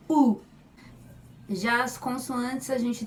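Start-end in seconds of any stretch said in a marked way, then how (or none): 0.1-0.4 U said open, higher and louder; an open "U", pitched up and projected. Shown as a demonstration. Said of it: "é aberta".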